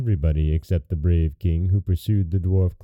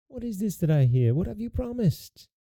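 high, soft distressed mother; mildly weeping queen; 12 semitones higher, 4 dB quieter than usual. A narrator's reading. Weeping queen questions her daughter's promise, mildly tearful. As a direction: plead in a thin raised voice, lightly tearful.

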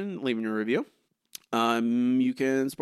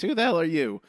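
mildly hostile, louder quoted retort; 5 semitones higher, 5 dB louder than usual. Retold or imitated. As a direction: lift it into a mock-hostile challenge. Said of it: "With such hostility".

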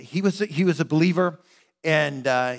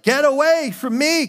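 higher, louder retold shout at the girl; upset shout; 10 semitones higher, 4 dB louder than usual. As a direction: fling it out like an angry friend. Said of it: "he actually was so upset".

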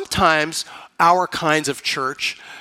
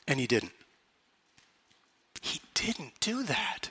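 hushed; 4 semitones lower, 13 dB quieter than usual. Acted. Dramatic drop after build-up.

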